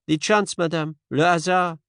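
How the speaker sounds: a touch of Poirot's polite scepticism; Poirot, gently dismissive. A narrator's reading. Poirot shrugs it off.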